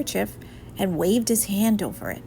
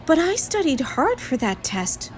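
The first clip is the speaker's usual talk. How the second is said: a touch of protest, voiced high; 8 semitones higher than usual. A narrator's reading. Arthur insisting to his friends, mildly protesting. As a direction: push back a little, defending yourself. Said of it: "Arthur protested".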